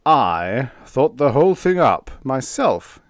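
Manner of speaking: loud, high, self-satisfied boast; proud. A narrator's reading. General claiming the whole idea as his own, proud. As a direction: puff it up proudly, big emphasis. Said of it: "he said proudly".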